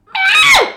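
squeaky high cat meow; shrill mock cat mew. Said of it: "I'm gonna go".

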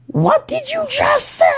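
extremely high falsetto screech; hammed-up, squealed outrage; mock shocked para. Para confronting a swearing student, mock shocked screech.

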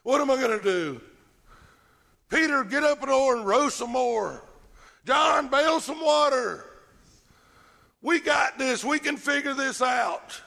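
high, loud, frantic orders. Acted. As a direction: bark out orders in a panic. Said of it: "you're just frantically".

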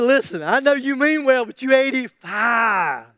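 loud, teasing reality check; mock incredulous objection. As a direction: talk the old man down, hammering his age. Acts a doubter answering old Caleb.

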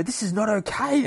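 high, alarmed objection; panicked protest. Acted. Acts comic victim's panicked protest.

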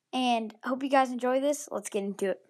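normal talking voice

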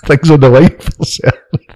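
words broken up by laughter; laughing through it. Real, not acted. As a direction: joke it out while laughing. Laughing while joking about risk.

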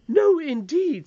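high, loud refusal; indignant. Acted. Nurse forbidding the boy's familiarity, indignant. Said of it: "said the nurse indignantly".

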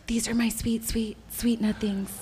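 soft breathy whisper, slow; sweet nothings whisper. Acted. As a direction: whisper slowly like a secret. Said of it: "Whisper sweet nothings in my ears".

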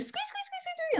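very high squeaky calf chirps; dolphin squeak imitation. Telling the host about the chatty calf, squeaking high like her. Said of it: "And she's like".

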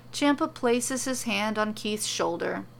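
ordinary delivery

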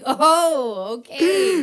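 high, loud, squeaked acknowledgement; mock embarrassed reply. Retelling her embarrassed reply.

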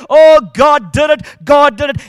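loud rising praise shout. Retold or imitated. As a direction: shout it out in joyful worship. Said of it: "She's shouting out".

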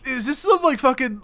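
normal talking voice

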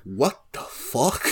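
slow, stretched, rising in disbelief; mock bewildered what-the-fuck, dragged out; drawn-out confused. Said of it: "And Yugi's like".

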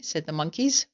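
usual speaking voice

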